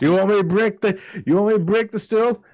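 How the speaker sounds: put-on furious drunk high-pitched taunt; drunken challenge. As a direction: dare him before swinging. Drunk challenges before swinging stool.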